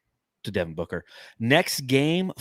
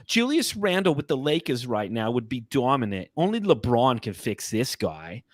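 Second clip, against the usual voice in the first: lightly put-on older New York lady accent, pitched higher; 4 semitones higher than usual. Reading Knicks subreddit comments to the co-host in a light older New York lady accent. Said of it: "like an older New York lady".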